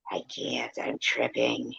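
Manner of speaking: unvoiced whisper; a whispered can't. Real, not acted. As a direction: breathe it out under your breath. Whispered aside.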